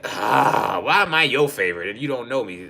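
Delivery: normal talking voice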